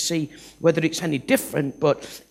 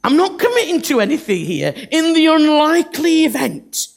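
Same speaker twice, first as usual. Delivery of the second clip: noncommittal Moses; 7 semitones higher, 5 dB louder than usual. Acted. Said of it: "He's like".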